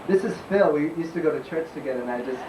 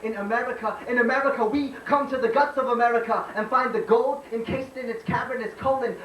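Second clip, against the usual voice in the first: spoken-word poem; 10 semitones higher than usual. Acted. Poet tells America of a mother's hands, declaimed spoken-word poem. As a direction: slam poet's raised-pitch declaiming.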